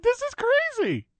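very high, slow mock squeal; excited squeal. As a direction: squeal like a thrilled fan. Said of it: "I'm like".

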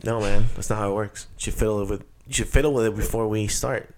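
normal talking voice

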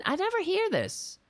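high, indignant squeal; exasperated squeal. Pretend. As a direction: squeal it in disbelief. Exasperated outburst.

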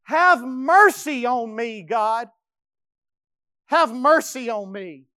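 slow, loud anguished cry for mercy; pleading. Acted. David begging God for mercy, loud and slow. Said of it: "this is what he says".

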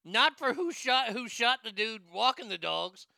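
ordinary talk

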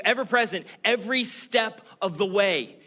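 high, loud and insistent, slowing on the last line; God's promise, loud. Acted. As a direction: proclaim each promise like a rising cry. Preacher voicing God's promise.

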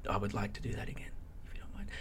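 coy little whisper. Real, not acted. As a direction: whisper it shyly, like a secret. Coy request dropped to whisper.

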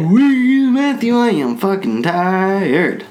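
high, drawn-out tired whine. Real, not acted. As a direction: whine it at Matthew, slowly. Calls out, tired.

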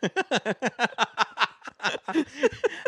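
shrill burst of laughter, far up; high squealing laugh. Real, not acted. Laughing at the Italian voice.

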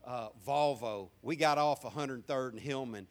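ordinary talk

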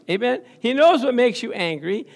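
ordinary delivery

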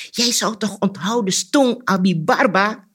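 high, loud, exasperated outburst; angry cry. A narrator's reading. Anansi loses his temper at the cricket, angry shout. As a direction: shout it angrily, drawing out the last words. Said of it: "Anansi werd kwaad en riep".

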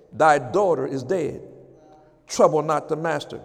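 low gloomy voice of doubt; a hint of the negative voice. Preacher voicing doubt, low and gloomy.

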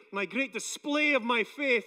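ordinary talk, nothing marked

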